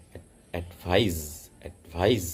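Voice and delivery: Advice with the weight on vice and a voiced z; stress on second syllable. Shown as a demonstration. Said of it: "stress second সিলেবেলে পড়বে".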